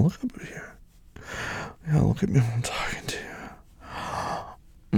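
hushed, intimate order; quiet but in control; whispered command. Acted. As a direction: whisper it close to your partner.

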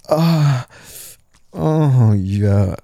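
usual speaking voice

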